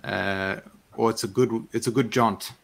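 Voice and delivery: rural Marish fisherman, feeling for the words; Marish accent. Acted. Fisherman explaining the distance to travellers, Marish accent. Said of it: "talking in this accent because it's amazing".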